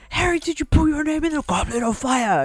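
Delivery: high, hammed-up yelled question; mock shout. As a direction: yell it like a panicked headmaster. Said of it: "why are you shouting".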